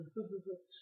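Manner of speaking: soft imitated chuckle; fatherly chuckle. Imitating God's laugh in heaven, soft fatherly chuckle. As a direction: attempt a low, gentle laugh, quietly. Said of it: "a deep fatherly laugh".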